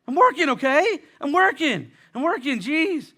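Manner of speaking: high, loud, irritated retort; defensive snap. Retold or imitated. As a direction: snap back like you've been accused. Defensive retort to imagined text.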